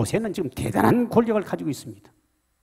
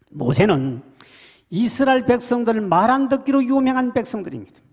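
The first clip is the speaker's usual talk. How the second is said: high, loud sermon delivery; full-force preaching; 9 semitones higher, 6 dB louder than usual. Acted. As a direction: preach it out to the hall. (높고 힘찬 설교)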